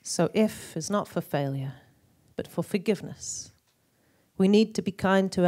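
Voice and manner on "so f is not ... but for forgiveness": soft, low lines fading toward a hush; gentle poetry. Acted. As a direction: let it sink quietly into forgiveness. Poem turns gently to forgiveness.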